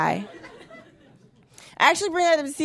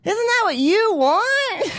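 high, very slow sarcastic sweetness; high sing-song sarcastic reply; mock sweet agreement; 7 semitones higher, much slower than usual. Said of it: "I'm like".

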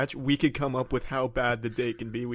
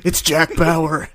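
dramatic reveal; 4 semitones higher, 6 dB louder, much slower than usual. Acted. Dramatic guard line.

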